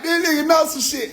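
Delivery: high startled shout; shocked shout. Retold or imitated. Reacting to the alien's taunt, high shocked shout. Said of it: "I'm like".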